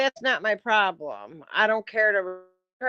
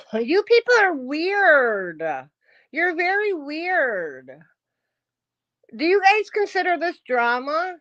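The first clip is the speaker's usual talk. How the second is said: slow, drawn out, higher; mocking; 5 semitones higher, 3 dB louder than usual. Real, not acted. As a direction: sneer it out slowly.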